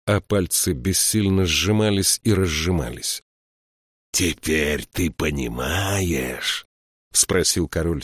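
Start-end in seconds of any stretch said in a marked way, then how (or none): 4.1-6.7 slow, drawn-out relish; savouring pleasure. A narrator's reading. King questioning the beaten count, savouring it slowly. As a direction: stretch each word out with gloating pleasure. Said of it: "сияя от удовольствия".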